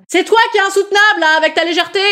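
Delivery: high, accusing retort; mock-indignant heckler voice; scolding heckler. Heckler figure throws insult back.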